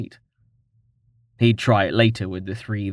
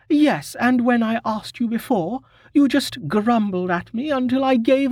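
high female voice, complaining; tattling on the grumpy smith; high-pitched aggrieved complaint; indignant young woman; 12 semitones higher than usual. Acted. Maharia complains to Tallheart.

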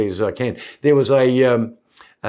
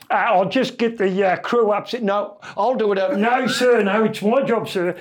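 higher eager sailor voice; the silly voice; 7 semitones higher than usual. Retold or imitated. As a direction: overeager goofy sailor. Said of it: "do the voice on him".

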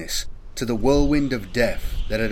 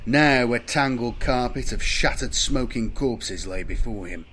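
ordinary delivery

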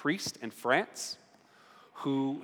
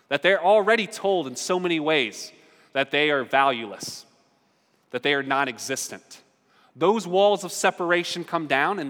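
louder, higher preaching build; fervent preaching; 4 semitones higher, 5 dB louder than usual. Acted. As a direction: preach it to the room with rising fire. Sermon climax on walls falling.

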